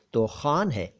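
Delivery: normal talking voice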